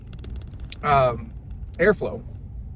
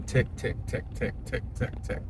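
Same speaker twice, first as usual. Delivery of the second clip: engine ticking imitation; 8 dB quieter than usual. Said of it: "Triton motor that was leaking exhaust".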